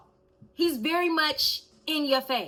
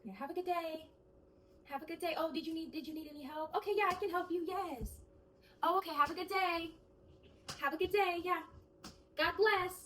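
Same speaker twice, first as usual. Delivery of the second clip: soft, higher cheery cashier voice; cashier politeness; 4 semitones higher, 10 dB quieter than usual. Shown as a demonstration. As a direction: greet customers sweetly, barely aloud. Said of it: "just like this".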